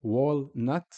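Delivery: "Walnut" split after wal, ending on T; stop on L and T. Shown as a demonstration. Teacher showing both sukoons, split syllables. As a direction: break "Walnut" at the L. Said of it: "we stop on the lam".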